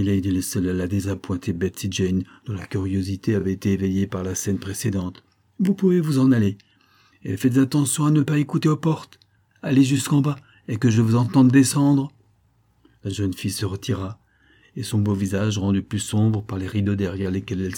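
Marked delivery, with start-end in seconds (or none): 5.6-12.1 raised, commanding order; stern dismissal. Acted. Lady dismisses the curious maid, stern command. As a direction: order her out, voice lifted and loud.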